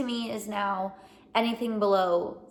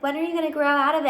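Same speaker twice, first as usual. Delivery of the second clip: mom voice; 5 semitones higher, 5 dB louder, much faster than usual. Retold or imitated. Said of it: "my mom being like".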